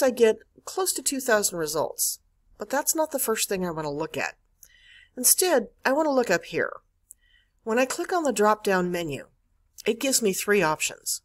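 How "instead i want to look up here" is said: ordinary talk, nothing marked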